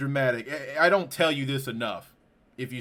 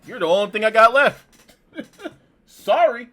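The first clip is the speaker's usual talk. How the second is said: high, loud, breaking into laughter; laughing through emotion; 9 semitones higher, 8 dB louder than usual. Real, not acted. Friend telling his buddy he's all he has left, laughing. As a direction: blurt it out between real laughs.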